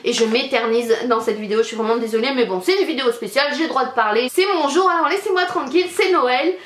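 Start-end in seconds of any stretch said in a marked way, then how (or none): 4.3-6.5 higher-pitched mock indignation; playful protest. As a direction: protest in a high voice, claiming your special day. Mock defiance after apologising for rambling. (protestation pour rire, voix aiguë)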